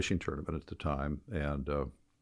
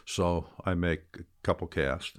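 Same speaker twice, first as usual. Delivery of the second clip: ordinary talk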